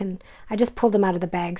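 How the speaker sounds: ordinary talk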